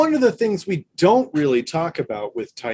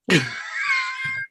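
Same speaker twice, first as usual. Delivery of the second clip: high laugh; 4 dB quieter than usual. Retold or imitated. Coworker laughs at caller.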